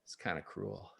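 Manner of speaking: ordinary delivery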